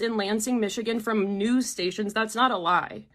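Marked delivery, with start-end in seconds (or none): none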